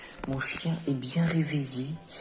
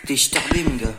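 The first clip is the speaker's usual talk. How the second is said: talking to a dog; 12 dB louder than usual. Real, not acted. Teasing the puppy, loud.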